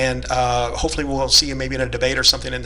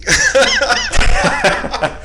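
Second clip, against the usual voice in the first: high maniacal cackle; unhinged villain; 4 dB louder than usual. Acted. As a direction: cackle like a madman. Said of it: "that's a serious laugh there".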